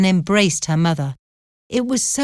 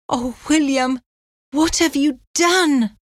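horror; 8 semitones higher than usual. A narrator's reading. Anne fears what her convict brother has done, horrified. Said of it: "exclaimed Anne in horror".

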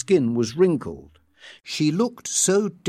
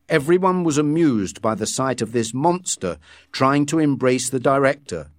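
ordinary delivery

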